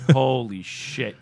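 ordinary delivery

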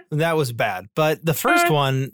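usual speaking voice

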